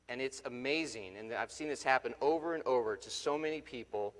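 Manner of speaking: usual speaking voice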